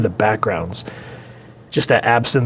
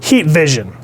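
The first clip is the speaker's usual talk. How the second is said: acted alarm; 5 semitones higher, slower than usual. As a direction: blurt it out as an imitated hero caught off guard. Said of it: "and he goes".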